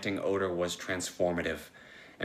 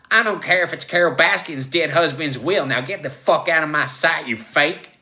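high, loud tirade; angry dismissal; 7 semitones higher, 10 dB louder than usual. Acted. Joe throws Logue out as a fake, furious shout. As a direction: shout him out of the room.

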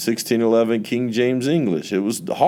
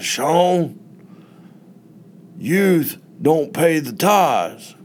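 a slow, high, pointed pastor's rebuke; old pastor turning down the youth leader, slow and high; stern pastor; 8 semitones higher, much slower than usual. Retold or imitated. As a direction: lay it down slowly, high, like a pastor's verdict. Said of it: "the way that he said it".

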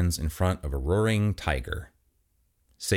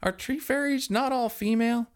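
pitched-up confused question; high puzzled voice. Acted. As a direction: ask it high and baffled. Chris puzzled by Charlie.

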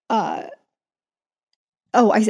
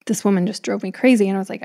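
ordinary talk, nothing marked

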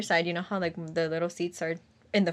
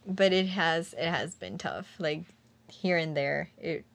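usual speaking voice

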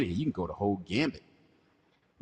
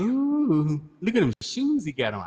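high, loud "ooh" of admiration; mock admiration; 8 semitones higher, 7 dB louder, slower than usual. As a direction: hoot it out like an impressed onlooker. Acting out an admirer spotting credits.

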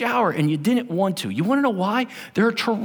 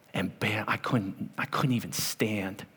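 hushed; 9 semitones lower, 10 dB quieter than usual. Retold or imitated. Retelling hip injury.

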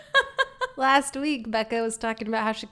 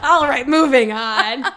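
laughing; 8 dB louder, slower than usual. Real, not acted. Laughing through the line.

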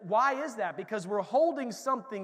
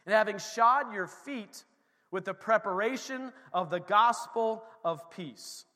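slow, louder reading of the verse; scripture reading; 4 semitones higher, 8 dB louder, slower than usual. Acted. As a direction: read the verse with weight. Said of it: "Verse fifteen".

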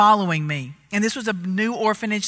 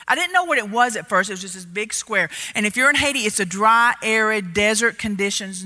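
normal talking voice

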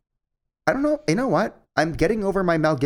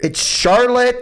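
excited blurt; 11 semitones higher, 5 dB louder, much slower than usual. Real, not acted. Blurting the answer.

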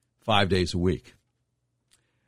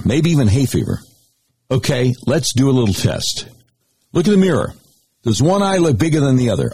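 upbeat, louder sales pitch; radio ad read; 2 semitones higher, 7 dB louder than usual. Acted. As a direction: sell it like a live radio spot. Host reads long sponsor ad.

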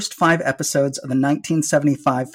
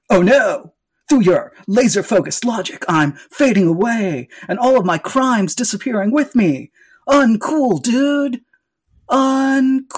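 overacted death scene; 6 semitones higher, slower than usual. Villain fades away defeated.

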